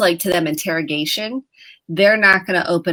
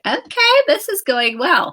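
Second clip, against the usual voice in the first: sarcastic okay; 8 semitones higher than usual. Retold or imitated. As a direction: fake upbeat parent, rolling eyes at the silence. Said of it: "And you're like".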